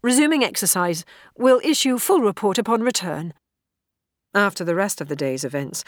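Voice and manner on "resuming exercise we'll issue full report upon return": high, loud official radio report; cadet reporting in. A narrator's reading. Cadet reporting to officer over comlink, formal report. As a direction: rattle off status phrases, raised in pitch and volume.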